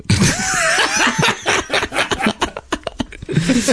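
long high shriek of laughter. Real, not acted. Laughing hard at the joke about sticks, shrieking. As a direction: burst out in a screaming fit of laughter.

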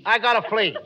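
ordinary delivery

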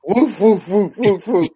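laughing woof woof; playful imitated dog barks; mock barking. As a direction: bark like a dog, half laughing.

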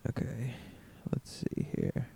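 soft unvoiced whisper. Real, not acted. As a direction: whisper it under your breath. Whispered while reading.

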